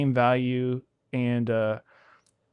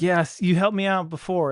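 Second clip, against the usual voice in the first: higher-pitched imitation of a sure customer; lightly hammed-up, insistent retold voice; mock insistent customer; 6 semitones higher than usual. Customer insisting to the wrong business owner, mock certainty.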